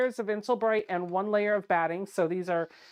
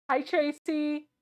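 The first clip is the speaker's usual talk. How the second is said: high squeaky greeting; 7 semitones higher, slower than usual. Acted.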